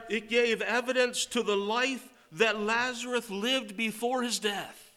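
high, loud sermon delivery; preacher proclaiming hope to the congregation, full force; full-force preaching. Acted. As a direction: proclaim it to the church.

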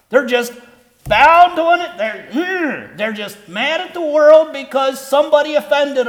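loud, high-pitched preaching push; preaching at full force. Acted. Preacher to congregation about grudges, loud and high. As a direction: hammer it home to the congregation.

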